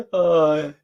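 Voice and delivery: ordinary delivery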